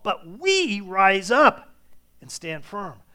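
loud, slow, declaiming scripture; preaching at full force. Acted. Preacher proclaiming David's testimony to the congregation, loud and slow. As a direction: thunder out the trust in God.

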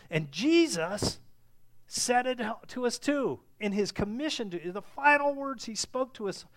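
ringing, emphatic pulpit delivery; impassioned preaching. Real, not acted. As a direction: preach it with force, pausing for weight. Preaches at full force.

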